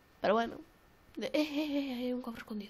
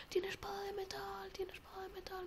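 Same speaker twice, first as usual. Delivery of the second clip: urgent whisper; 7 semitones higher, 12 dB quieter than usual. Real, not acted. Hiding from the armed enemy, urgent whisper. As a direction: drop to a frightened whisper.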